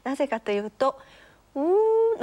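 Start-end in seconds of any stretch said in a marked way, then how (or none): 1.6-2.2 high, long-held "う" with puckered lips. Shown as a demonstration. Teacher explaining the mouth shape for ü, long high "う". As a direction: stretch out a puckered "う". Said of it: "うの口をして".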